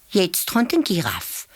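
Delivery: ordinary delivery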